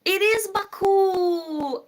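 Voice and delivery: loud, high, drawn-out exclamation; triumphant cry. Real, not acted. Streamer recalling the capital of Azerbaijan, triumphant. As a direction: shout it out in triumph, stretching the end.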